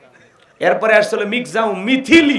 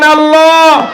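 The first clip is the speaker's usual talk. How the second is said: long, high shout of the name; fervent shouted call; loving loud call; 9 semitones higher, 6 dB louder, much slower than usual. Shown as a demonstration. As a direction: call out with love and full voice. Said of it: "ভালোবেসে ডাক দেন".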